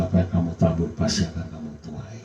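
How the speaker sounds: ordinary talk, nothing marked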